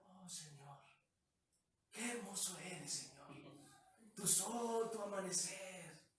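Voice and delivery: soft, hushed put-on prayer; mock-devout, softened praying voice; changed praying voice. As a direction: imitate a yeller turned meek in prayer. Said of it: "Y cuando ora".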